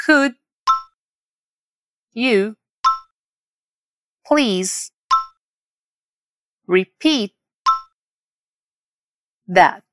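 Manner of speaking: "Could", "you", "please", "repeat", "that" with long pauses between; word by word. Shown as a demonstration. Teacher drilling learners, word by word. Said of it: "Vamos repetir palavra por palavra agora".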